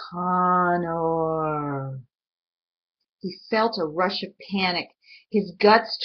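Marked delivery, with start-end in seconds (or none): 0.1-2.0 low, drawn-out monstrous call; monster calling. Acted. The monster calling Connor from the dark, drawn out. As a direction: call his name like something vast outside.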